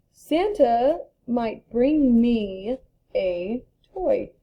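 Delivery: very slow, careful sentence; slow example read. Shown as a demonstration. Teacher reading the example sentence to class, slow. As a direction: read it slowly so kids follow.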